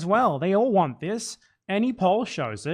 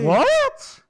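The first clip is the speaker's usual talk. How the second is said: high, squeaky mock disbelief; hammed-up gasp of a question; mock shock; 5 dB louder than usual. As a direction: fake being stunned by the news. Sarcastic mock disbelief bit.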